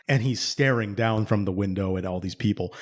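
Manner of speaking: usual speaking voice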